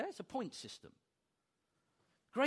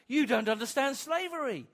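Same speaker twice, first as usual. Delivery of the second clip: usual speaking voice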